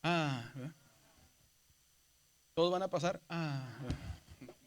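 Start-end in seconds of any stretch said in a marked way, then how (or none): 0.0-0.5 "ah" larga, algo grave, en arco; sube y baja. Shown as a demonstration. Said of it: "sube y baja". 3.3-3.7 "ah" muy lenta, grave y suave; sube y baja. Shown as a demonstration. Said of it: "sube y baja".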